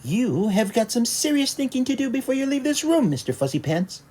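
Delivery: high mother voice laying down the law; stern mother. A narrator's reading. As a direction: lecture him like a stern mom. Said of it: "said his mother".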